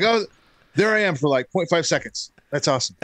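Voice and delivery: high, delighted spotting yourself; thrilled kid finding himself on video; mock childlike excitement; excited kid. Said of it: "I was like".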